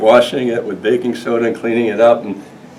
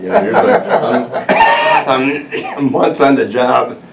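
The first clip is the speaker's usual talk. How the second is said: laughing; 4 semitones higher than usual. Real, not acted. Laughing recalling being drunk.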